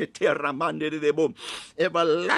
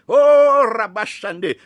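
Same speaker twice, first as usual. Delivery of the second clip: loud, high preacher's cry; fervent shouted exclamation; ecstatic outburst; 4 semitones higher, 9 dB louder, slower than usual. Real, not acted. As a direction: burst out high and long, full voice. Preacher breaks into tongues.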